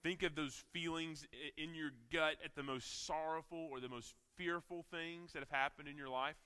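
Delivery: ordinary talk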